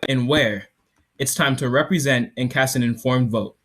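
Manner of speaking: loud, projected product demo; startup pitch. Acted. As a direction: sell it to the judges.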